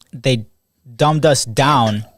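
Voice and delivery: normal talking voice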